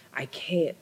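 unvoiced, breaking I can't; a real whisper of regret. Real, not acted. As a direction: let it fall to a whisper. Wishing to undo trauma.

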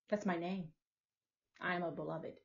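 ordinary talk, nothing marked

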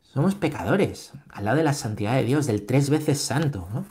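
ordinary talk

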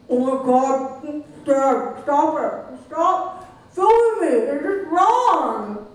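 put-on high, loud, slow whining kid; rolling eyes. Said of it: "we will play that for you now".

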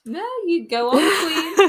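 laughing, high and loud. Real, not acted. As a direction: cheer her on through laughter. Cheering friend on, laughing.

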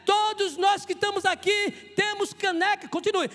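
high, shouted pulpit oratory; full-force preaching. Real, not acted. Preacher telling the church everyone has broken mugs, shouted preaching. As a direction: hammer each phrase out to the congregation.

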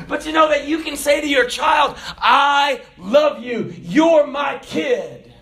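loud, high, fervent preaching; preaching at full force. Acted. Preacher urging parents to praise kids, fervent. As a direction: drive it home to the congregation.

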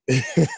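short amused chuckle at himself; real laugh. Real, not acted. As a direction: laugh it off, embarrassed. Laughing at being an hour late.

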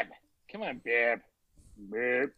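put-on soft, fading Massachusetts accent. Friend chiding Barb, in a soft Massachusetts accent. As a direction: repeat Barb quietly, Massachusetts vowels. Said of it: "Massachusetts accent".

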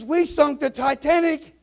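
high, loud, slow disbelieving question; acted incredulity. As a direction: throw the accusation back, astounded. Acted pilot disbelieves accusation.

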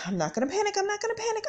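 second repeat jumps way up in pitch; self-soothing that turns into a squeak; forced calm, cracking high. Retold or imitated. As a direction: tell yourself not to panic, voice squeaking. Talking herself down from panic.

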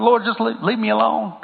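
a touch of exasperated prayer; mock pleading. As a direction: whine it like someone who wants peace. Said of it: "Sometimes I say".

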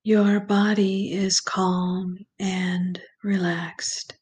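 slow, calm meditation voice with long pauses; guided meditation. Acted. Guide leads listeners through breathing and visualization, slow meditation voice. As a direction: ease the listener gently into relaxation, unhurried and even.

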